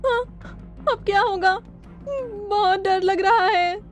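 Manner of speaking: slow, drawn-out fearful voice; afraid. Acted. Companion surrounded by circling tribesmen, afraid. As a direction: drag out the worry fearfully.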